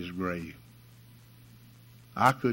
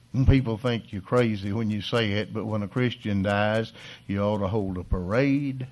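ordinary delivery

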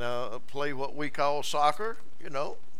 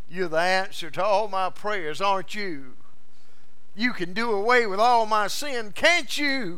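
building joy; 9 semitones higher, 4 dB louder than usual. Acted. New believer addressing the Savior.

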